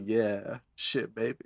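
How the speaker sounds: low, soft seductive growl; imitating Tyler's Vine, low and husky; low sultry quote. Re-quoting the Vine, quieter.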